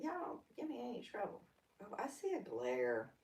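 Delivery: ordinary talk, nothing marked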